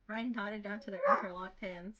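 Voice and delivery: ordinary delivery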